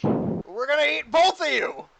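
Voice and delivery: loud, high monster yell; hungry orc shout. Acted. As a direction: roar the threat as a ravenous orc. Game master voices shouting hungry orc.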